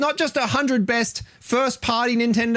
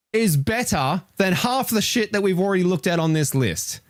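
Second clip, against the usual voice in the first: ordinary talk, nothing marked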